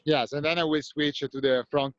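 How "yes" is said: ordinary talk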